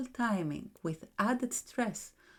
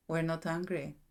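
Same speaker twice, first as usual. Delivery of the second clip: ordinary delivery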